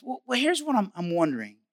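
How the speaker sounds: normal talking voice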